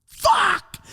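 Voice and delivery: high-pitched retold shout of despair; shrieked curse, acted out; frustrated shout. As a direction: cry out as your escape plan collapses. Retold panic, trapped in bathroom.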